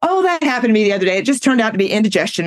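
quick-fire, dismissive rattle; fast, unconcerned patter; breezy chatter. Retold or imitated. Chatty friend brushing off her symptoms.

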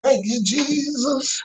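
high, slow churchy exclaim; preacher voice. Retold or imitated. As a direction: lift it up like a preacher. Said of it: "Preachers copy other preachers' styles".

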